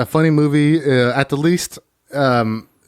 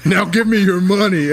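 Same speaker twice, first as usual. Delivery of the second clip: higher, slow, put-on robber voice; hammed-up tough woman robbing him; demanding mugger; 5 semitones higher than usual. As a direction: demand the money like a mock mugger. Mock mugging by assertive woman.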